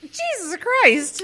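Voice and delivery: high, slow, stunned exclamation; shocked. Real, not acted. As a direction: let it out slowly in disbelief. Reacting to shingles at seventeen.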